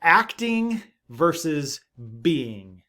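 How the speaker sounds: acting and being stretched out slowly; slow emphatic contrast. Shown as a demonstration. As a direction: land each word deliberately, acting, then being. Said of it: "two important words".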